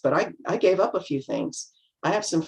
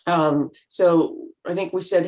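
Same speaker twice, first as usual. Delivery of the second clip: normal talking voice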